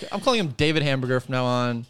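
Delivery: ordinary talk, nothing marked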